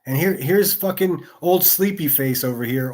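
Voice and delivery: usual speaking voice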